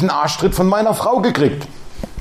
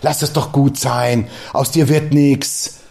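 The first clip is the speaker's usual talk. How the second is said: devil's taunt; 7 semitones lower than usual. Retold or imitated. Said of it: "wie oft der Teufel zu mir kommt".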